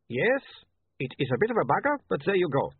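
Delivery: a hint of an Uberwaldian photographer; lightly voiced Uberwaldian accent. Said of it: "don't make fun of his accent".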